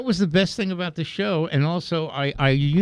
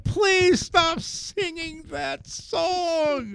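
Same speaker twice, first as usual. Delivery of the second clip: high, drawn-out mock pleading; imitating a plea; 13 semitones higher, much slower than usual. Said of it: "they were asking them".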